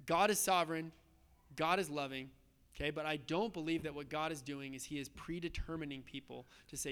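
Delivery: normal talking voice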